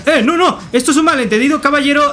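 high, urgent protest of innocence; alarmed protest. Pretend. Player to an attacking gentleman over a misunderstanding, alarmed protest.

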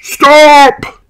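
very high, loud yell; Mister Bison's yell. A narrator's reading. Mister Bison halts the driving family, yelling. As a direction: yell to halt them. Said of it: "yelled Mister Bison".

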